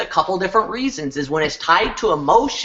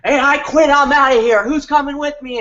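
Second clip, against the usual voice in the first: high, loud defiant walkout shout; mock-dramatic "I quit" outburst, pitched way up; Jerry Maguire; 10 semitones higher, 5 dB louder than usual. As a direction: announce it to the whole office. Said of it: "pull like a Jerry Maguire".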